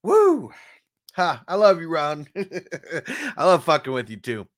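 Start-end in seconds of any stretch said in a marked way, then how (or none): 0.0-0.5 high, loud "woo" after coughing; a real whoop of relief. Real, not acted. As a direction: whoop it out high, then drop. Recovering from coughing after dab.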